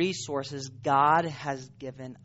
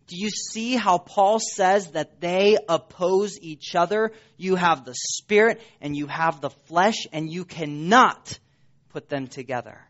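normal talking voice

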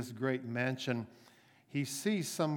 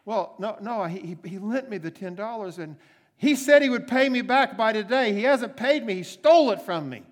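indignant complaint; 8 semitones higher, 11 dB louder than usual. Acted. Servant complains to policeman.

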